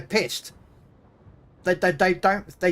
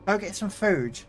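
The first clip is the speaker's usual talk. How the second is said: light, higher matey voice; kindly bouncer; 5 semitones higher, 4 dB quieter than usual. Retold or imitated. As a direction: gently talk a drunk out of buying. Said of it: "You're like".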